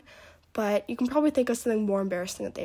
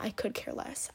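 "could" in a quiet scornful whisper; mocking wrong version; 9 dB quieter than usual. Shown as a demonstration. Girl correcting Leland, softly mocking the wrong "could". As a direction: throw away the wrong "I could care less" softly.

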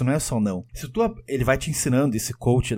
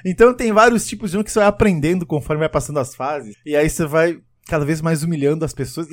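normal talking voice

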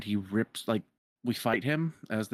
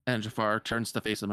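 normal talking voice

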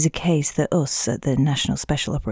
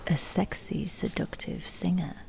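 sultry whisper; 3 semitones higher, 10 dB quieter than usual. Pretend. Describing her sexy undercover role.

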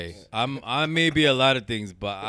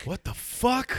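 much higher, slow stunned echo; mock shock; 12 semitones higher, slower than usual. Listener echoes the storyteller's shock, high and drawn out. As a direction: echo his shock in an exaggerated way.

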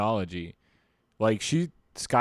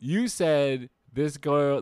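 ordinary talk, nothing marked

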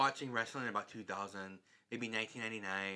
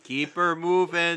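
higher, louder, slow folksy Wisconsin drawl; hammed-up Wisconsin; 7 semitones higher, 12 dB louder, slower than usual. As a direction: ham up the Wisconsin catchphrase. Mocking Wisconsin catchphrase.

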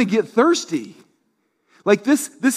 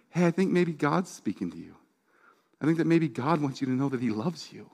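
soft, tender pastoral voice; quiet, careful reassurance; gentle reassurance; 3 semitones lower, 8 dB quieter than usual. Retold or imitated. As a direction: say it softly, as if comforting someone in tears. Pastor consoling a crying woman, retold.

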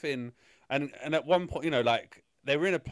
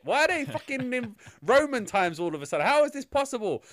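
rising higher, incredulous swearing; exasperated rant; 7 semitones higher, 3 dB louder than usual. Retold or imitated. Podcaster ranting to co-hosts, higher and louder. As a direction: rant in disbelief at the plot. Ranting retelling confused reaction.

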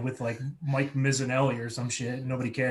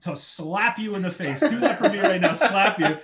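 high, louder laughing order; radio host daring the caller to slap himself, laughing; mock host command; 6 semitones higher, 6 dB louder than usual. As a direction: goad him with a laugh. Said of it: "He's like".

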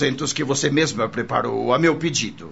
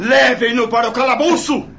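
roaring tyrant king; 9 semitones higher, 5 dB louder, faster than usual. Acted. Lord orders prisoner to dungeon.

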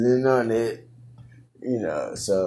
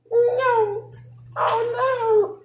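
tiny squeaky falsetto; very high, put-on cry of dismay; mock squeal; 4 dB louder than usual. Imagining mice loose at school, mock squeal.

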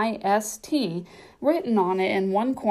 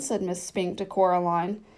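ordinary delivery

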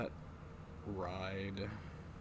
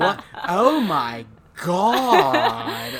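slow, higher, louder drawn-out gasp of awe; older-sounding girl; 7 semitones higher, 13 dB louder, much slower than usual. Retold or imitated. Classroom girl gasping at the bird, slow high mock awe. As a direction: ham up a too-old kid gasping in class. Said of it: "This one girl who sounds like she's much older than everybody".

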